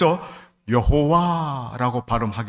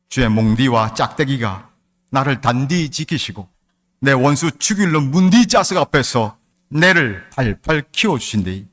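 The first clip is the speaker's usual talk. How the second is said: Gyeongsang dialect reading; 5 dB louder than usual. Shown as a demonstration. Pastor reads Psalm 23 to congregation in loud Gyeongsang dialect. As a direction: read the psalm in thick Gyeongsang dialect, hammed up. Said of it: "경상도 버전으로".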